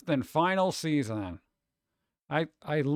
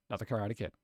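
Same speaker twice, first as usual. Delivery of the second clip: much lower and softer, deadpan; dry mutter; 7 semitones lower, 9 dB quieter, much faster than usual. Real, not acted. To the chat, rolling eyes at the sequel, low deadpan mutter. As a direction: grumble it to yourself. Repeats the title again.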